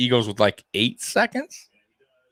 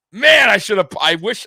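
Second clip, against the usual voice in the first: higher, louder excited groan; kicking himself, still buzzing; excited regret; 8 semitones higher, 7 dB louder than usual. Real, not acted. Wishing he had bet on it, excited.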